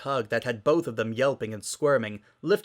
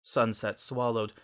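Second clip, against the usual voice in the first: ordinary delivery, nothing marked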